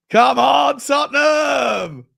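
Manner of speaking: loud, high, long drawn-out Tottenham cheer; rallying shout. Real, not acted. Host cheering his club's transfer news to his co-host, with a drawn-out shout. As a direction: belt it out like a terrace chant.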